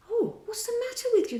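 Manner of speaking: very high, fast and worried; frightened fairy-high cry. A narrator's reading. Peter suddenly afraid for Tink, crying out high and fast. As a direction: Peter panics over his fairy. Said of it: "suddenly afraid".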